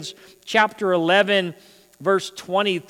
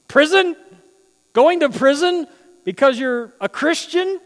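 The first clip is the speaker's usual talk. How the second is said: high, louder disbelieving echo; incredulous; 8 semitones higher, 4 dB louder than usual. Acted. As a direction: repeat it in shocked disbelief. Incredulous echo of scripture word.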